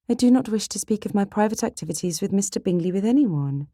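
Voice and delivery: high, firm refusal; reserved young lady's protest; prim sister Jane. Acted. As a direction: shut down the prying in a lifted voice.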